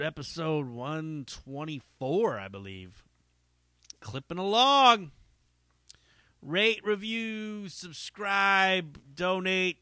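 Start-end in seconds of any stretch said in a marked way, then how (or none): 4.0-9.8 louder, higher announcer plug. Pretend. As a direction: sell it like a host.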